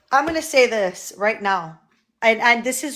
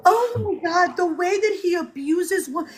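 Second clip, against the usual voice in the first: much higher, gasping mock dismay; mocking fake crying outrage; 8 semitones higher than usual. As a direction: parody a crying, clutching-pearls outrage. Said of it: "fake outrage".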